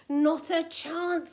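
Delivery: huffy and defiant; sulky refusal. A narrator's reading. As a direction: refuse stubbornly, stretching the words. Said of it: "sulked Tiny".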